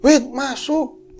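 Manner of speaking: high, drawn-out call; calling child indoors. Acted. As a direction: call someone in, slowly and high. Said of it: "bilangin gitu".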